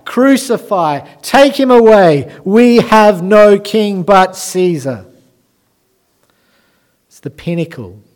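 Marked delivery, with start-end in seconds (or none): 0.0-2.3 high, loud mob cry; crowd shouting. Acted. The crowd demanding crucifixion from Pilate, loud and high. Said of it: "And they say". 2.4-5.0 "We have no king but Caesar" slow, loud and high, hammered out; mob shout. Acted. The crowd rejecting Jesus before Pilate, slow loud shout. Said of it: "And they say".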